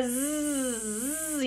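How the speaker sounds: "zzz" held out as one continuous buzz; long Z sound. Shown as a demonstration. Therapist showing one of those longer sounds, "zzz" drawn out. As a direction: sustain "zzz" without a vowel. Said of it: "one of those longer sounds".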